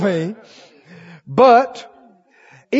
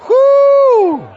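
high loud preacher whoop; exultant whoop; 6 dB louder than usual. Real, not acted. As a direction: let out a joyful whoop. Preacher exults after quote.

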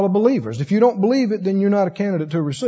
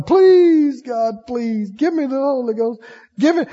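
mock hollering; 4 semitones higher than usual. Seeker at the altar begging God, mock hollering. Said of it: "I just kept hollering".

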